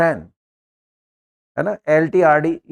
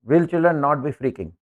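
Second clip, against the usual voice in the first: slow dictation; much slower than usual. Shown as a demonstration. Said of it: "dictating answer".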